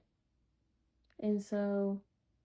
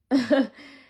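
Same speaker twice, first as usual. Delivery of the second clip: sudden loud laugh; burst of laughter; 4 semitones higher, 12 dB louder than usual. Real, not acted. Storyteller laughing after her own retort, loud burst of laughter. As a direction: break out in a loud, short laugh.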